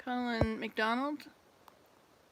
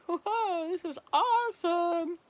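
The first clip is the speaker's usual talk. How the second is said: excited; 8 semitones higher, slower than usual. Acted. Adam thrilled at the offer.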